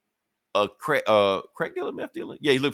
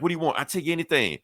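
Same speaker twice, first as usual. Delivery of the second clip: mock pleading; 6 semitones higher than usual. Dangling thug begs.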